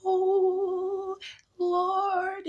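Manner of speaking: high, soft churchy "Oh, Lord"; lightly hammed-up holy reverence; mock pious sigh. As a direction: imitate someone swooning over a holy God. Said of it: "people think God is".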